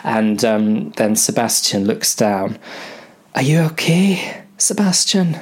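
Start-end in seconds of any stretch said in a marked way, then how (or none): 3.4-5.4 high character voice. Acted. Crew asks dying Sebastian.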